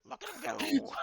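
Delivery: high chirpy repeat; high creature chirp. Acted. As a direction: chirp it like a tiny robot. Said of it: "Calcumon catchphrase repeated".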